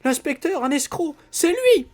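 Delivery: the wife realises the inspector was the thief; frantic disbelief. Acted. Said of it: "Elle poussa de véritables hurlements". (la femme, paniquée, voix très aiguë)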